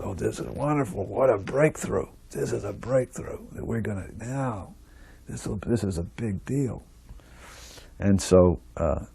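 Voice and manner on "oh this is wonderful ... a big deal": much softer, awed aside; excitement kept under the breath; hushed wonder. Retold or imitated. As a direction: imitate onlookers quietly marvelling. Devotees' excited thoughts voiced, hushed.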